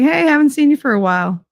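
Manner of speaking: breezy high fake-friendly hello; mock casual greeting. Mocking the person slipping back in, fake cheerful. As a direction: act like nothing happened.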